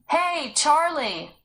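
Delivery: high, loud, slow shout. Acted. Joe shouting for Charlie, loud slow call. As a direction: call out for the boy. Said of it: "Joe shouted".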